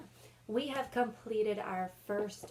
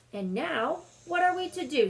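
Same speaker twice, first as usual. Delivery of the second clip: high, louder exasperated question; worried Edmund; 8 semitones higher, 7 dB louder than usual. Acted. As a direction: whine it out like a fed-up boy. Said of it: "said Edmund".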